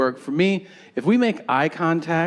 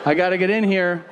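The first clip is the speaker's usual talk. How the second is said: forced brush-off; 5 semitones higher than usual. Retold or imitated. Brushing off a chatty guard.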